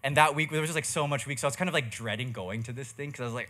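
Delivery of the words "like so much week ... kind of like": ordinary talk, nothing marked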